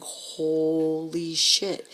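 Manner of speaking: long drawn-out, stunned swearing; stunned awe. Retold or imitated. Driver reacting to the slabs and people on the ground, stunned. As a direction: stretch it right out in disbelief.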